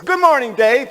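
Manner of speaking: high, loud imitation of a greeting; cheery greeting. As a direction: sing out an enthusiastic hello. Drive-thru worker greeting him cheerily.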